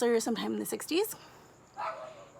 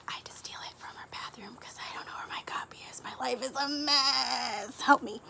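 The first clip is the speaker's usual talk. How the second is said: confiding whisper; 3 semitones higher, 4 dB quieter than usual. Real, not acted. Confessing she took mom's copy.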